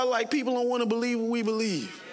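ordinary talk

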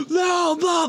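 high shouted line over blaring music; movie line shouted. Retold or imitated. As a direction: yell it like an actor drowned out by the score. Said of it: "the music is way louder than the dialogue".

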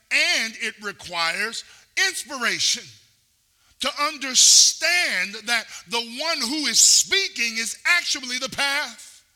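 building preaching. Real, not acted.

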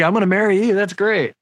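mock smitten. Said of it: "was like".

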